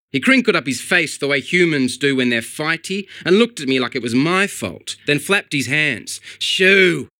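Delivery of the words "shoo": loud, sharp shout at a bird; shoo. A narrator's reading. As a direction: shoo it away loudly. Barry flaps hands at pigeon.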